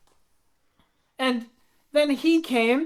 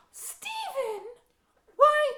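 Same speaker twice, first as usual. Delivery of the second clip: high gasp, then a higher squeaky Why; gasping surprise; 10 semitones higher, 6 dB quieter, slower than usual. Acted. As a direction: gasp at the name in mock shock. Young Annabelle, startled by name.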